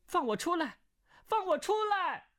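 high-pitched cry to be let out; shouting. Acted. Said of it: "穆婉清大叫". (高声大叫)

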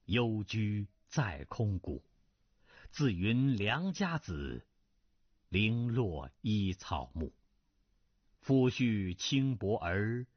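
slow, grieving classical verse; slow poem recital. A narrator's reading. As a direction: let each line of the poem sink in slowly. (缓慢沉痛地吟诵古诗)

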